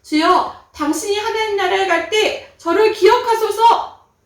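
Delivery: high, loud earnest plea; the repentant robber begging Jesus to remember him; robber pleading. Acted. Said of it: "예수님께 이렇게 얘기하는 것이었어요".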